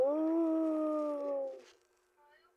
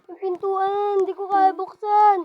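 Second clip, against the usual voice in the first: panicked shouting; 3 semitones higher, 14 dB louder than usual. Real, not acted. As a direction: pound on the door and scream. Trapped, begging to be let out.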